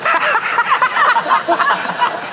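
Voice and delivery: high burst of laughter; host breaking up at the panel's nitpicking; big laugh. Real, not acted. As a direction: crack up helplessly.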